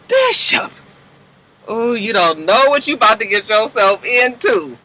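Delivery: high teasing call of his name; mock warning. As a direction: warn him in a playful singsong. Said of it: "I said".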